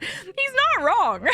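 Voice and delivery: high, slow words through laughter; laughing hard. Real, not acted. Admitting partner was right, laughing hard. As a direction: giggle the words out. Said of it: "I started laughing so hard".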